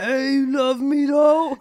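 loud, slow, hammed-up sleepy plea; mock deep voice. Wife with wild morning hair to husband, comically pleading. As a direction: draw it out loudly as a comic morning voice. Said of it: "with my deep voice".

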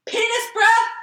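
put-on high, loud kid shout; movie quote yell. Quoting the movie line to her friend as a high, loud kid's yell. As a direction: shout it like the boy in the film. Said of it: "He goes".

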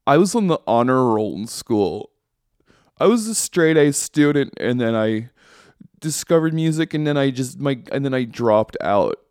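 mocking slacker friend. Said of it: "our fuck up friends are like".

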